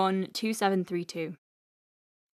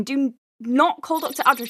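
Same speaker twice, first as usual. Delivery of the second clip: ordinary talk, nothing marked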